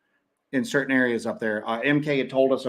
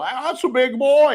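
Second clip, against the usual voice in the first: put-on slow, high Southern "That's a big boy"; imitating a friend's Southern drawl; 11 semitones higher, 6 dB louder, slower than usual. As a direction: put on a thick Southern accent, drawn out and louder. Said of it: "a real Southern kind of".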